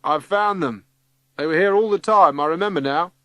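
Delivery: shouting down. A narrator's reading. Cyril calling from a high cupboard to Vi, shouting. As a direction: call the good news down. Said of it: "Cyril, shouts down".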